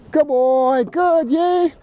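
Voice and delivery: high, drawn-out cheer for a dog; high-pitched dog praise. Real, not acted. Trainer rewarding his dog mid-lesson, high-pitched praise. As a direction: praise him in a high, happy sing-out.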